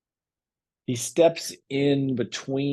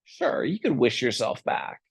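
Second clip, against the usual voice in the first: a hint of a scheming genie, pitched higher; higher, lilting pitch for a persuasive genie; lightly voiced genie; 6 semitones higher than usual. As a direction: coax with a lightly acted, higher voice. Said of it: "And the genie says".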